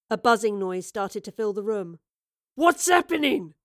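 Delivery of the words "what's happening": high, loud, alarmed shout. A narrator's reading. Leader shouting as a buzzing fills the room, shout. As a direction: shout it, demanding an answer. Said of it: "the leader was shouting".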